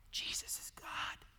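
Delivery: slow, solemn whisper; hushed revelation. Real, not acted. Preacher reveals who Jesus is, in a slow whisper. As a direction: drop to a whisper and let it sink in. Quiet reveal after stirred-up questions.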